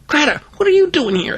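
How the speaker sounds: put-on high-pitched surprised kid; light startled question, pitched high; startled stammer. A narrator's reading. Boy finds Greta behind him, high startled question. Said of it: "I stammered".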